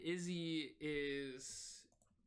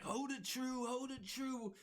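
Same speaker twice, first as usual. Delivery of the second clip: Izzy's urging voice; 8 semitones higher than usual. Acted. Izzy to Garrett at the helm, a high urgent call. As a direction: press the helmsman to hold course. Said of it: "Izzy's like".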